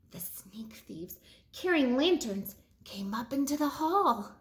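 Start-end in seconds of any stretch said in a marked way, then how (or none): 0.1-1.2 hushed, slow suspense whisper. A narrator's reading. Storyteller whispering as thieves sneak in. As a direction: breathe it out as the thieves appear. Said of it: "suspense whisper in narration".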